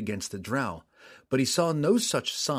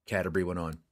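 ordinary delivery

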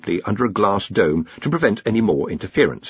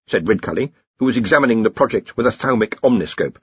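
ordinary delivery, nothing marked

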